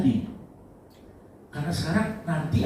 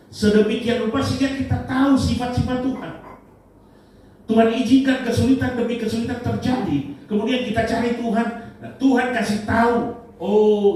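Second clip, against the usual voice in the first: high, raised pulpit voice; preaching at full force; 10 semitones higher, 4 dB louder than usual. Real, not acted. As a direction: drive each point home to the congregation, high and emphatic. (berkhotbah lantang dengan nada tinggi)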